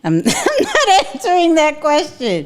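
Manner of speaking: giggling no; laughing refusal. Real, not acted. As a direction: decline while cracking up.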